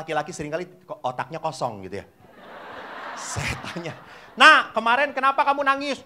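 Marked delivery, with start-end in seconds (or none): 4.4-6.0 higher, louder questioning; questioning priest. Acted. Priest asks a boy about yesterday's tears, raised questioning voice. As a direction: call on the child for an answer.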